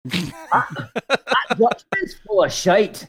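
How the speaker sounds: a slow mock Scottish brogue; Scottish accent. Co-host tries a Scottish accent. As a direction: play a gruff Scotsman. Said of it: "Scottish accent".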